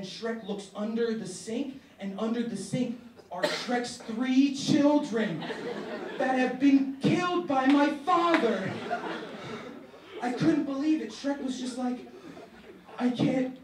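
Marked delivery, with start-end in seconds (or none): 2.0-13.6 rising to loud, high storytelling; building excitement. Real, not acted. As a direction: push the punchline up and loud. Climax told louder, laughing.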